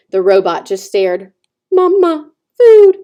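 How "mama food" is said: put-on high, tiny baby-bird peep; a high, tiny newborn peep calling for mama; tiny peeping gosling. A narrator's reading. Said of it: "said the gosling".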